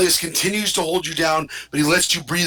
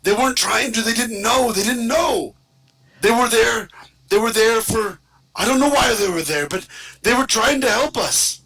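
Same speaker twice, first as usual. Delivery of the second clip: higher, pleading defender voice; Tomas, pleading; 6 semitones higher than usual. Acted. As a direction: plead their innocence, voice up. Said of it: "And Tomas goes".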